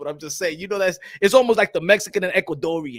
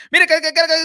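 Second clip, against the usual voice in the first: fast Spanish mock; 10 semitones higher, 7 dB louder, faster than usual. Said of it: "make fun of us 'cause we talk fast".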